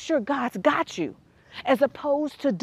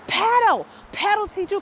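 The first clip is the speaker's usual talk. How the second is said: raised, higher yell to pedal; 7 semitones higher than usual. Retold or imitated. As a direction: shout at her to keep pedaling.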